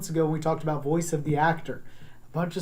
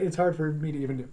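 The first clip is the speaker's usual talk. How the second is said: ordinary talk, nothing marked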